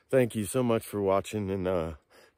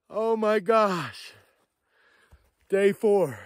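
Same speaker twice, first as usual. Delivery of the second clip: overwhelmed joy; 9 semitones higher, 4 dB louder, much slower than usual. Real, not acted. Overwhelmed after finding the shed.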